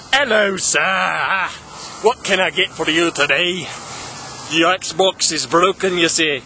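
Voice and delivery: put-on slow, stretched Scottish accent. Clerk in a kilt greeting customer, hammed-up Scottish accent. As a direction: put on a drawn-out Scottish brogue. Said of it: "in a Scottish accent".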